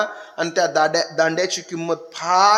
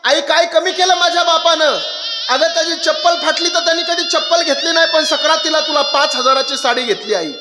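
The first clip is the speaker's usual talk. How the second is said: high, pleading, on the edge of tears; a bride begging her mother, voice breaking; tearful daughter; 8 semitones higher than usual. Acted. (निरोप घेणारी रडवेली मुलगी, उंच आवाजात)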